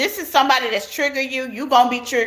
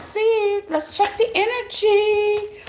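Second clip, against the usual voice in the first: sing-song; 10 semitones higher, slower than usual. Acted. Tarot reader settling into reading.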